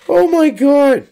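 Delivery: slow exasperated exclamation; exasperated oh my god. Real, not acted. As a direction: stretch it slowly in disbelief. Reacting to article.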